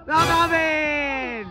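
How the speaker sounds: very slow, stretched shout; long name call. Real, not acted. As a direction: holler the name, holding the vowels. Calling out a name during play.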